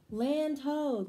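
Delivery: loud, high, triumphant lookout call. A narrator's reading. Captain spots land from the ship, loud call. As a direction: belt it out like a sighted shore. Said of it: "cried Captain Bouncer".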